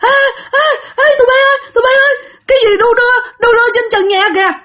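two men see something swinging above and scream in fright; terrified yell. Retold or imitated. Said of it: "hai ông này la làng lên".

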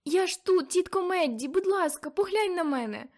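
very high, loud pleading cries; desperate plea. Acted. Girl shaking her aunt, desperately pleading.

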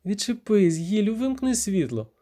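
high, irritated female character voice; annoyed protest. Acted. Віра, struggling free from the narrator, irritated protest.

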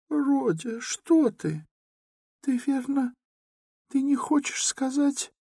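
very high, startled mother; frightened. A narrator's reading. Mother frightened by her son's words, very high. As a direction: gasp it out in alarm. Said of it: "в испуге Пульхерия Александровна".

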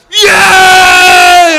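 huge, high, long-held cheer; crowd cheer. Retold or imitated. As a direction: erupt like a whole room cheering. Acts out the room suddenly cheering.

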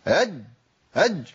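"هج" jabbed with a jump in pitch; short, hard-pushed "هج"; mock forceful jim. Shown as a demonstration. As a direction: exaggerate the push into the jim of "هج". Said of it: "خلي الامور سهلة كده".

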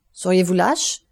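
scornful question with rising end; mild, cutting contempt; contemptuous exclamation. A narrator's reading. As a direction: throw the question out with disdain. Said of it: "s'écria Villefort d'une voix méprisante".

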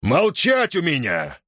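властный окрик «Молчать»; крикни «Молчать» с силой. A narrator's reading. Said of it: "и крикнул". (громкий окрик)

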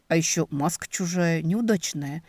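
ordinary delivery

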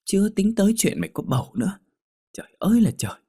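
very high, wailing dismay; high-pitched, exasperated outcry; aghast exasperation. Acted. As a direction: lament your friend's foolishness. Friend aghast at possible pregnancy.

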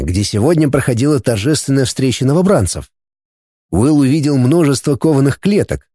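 plain reading voice

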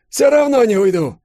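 put-on high, loud defiant old man; fearless old man. A narrator's reading. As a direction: dare him to strike. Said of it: "без страха заявил Савельич". (старик бесстрашно бросает вызов)

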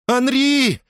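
high cry of alarm; alarmed scream. A narrator's reading. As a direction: cry his name out in fright. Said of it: "закричала Сирена".

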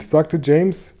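plain reading voice

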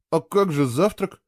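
a touch of disappointed protest. A narrator's reading. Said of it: "разочарованно спросил Фло". (слегка разочарованно)

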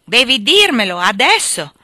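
loud, forceful order; angry demand. A narrator's reading. Husband loudly orders his wife to tell him now. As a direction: bark the command at her.

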